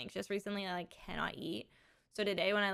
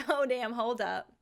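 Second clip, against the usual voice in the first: ordinary talk, nothing marked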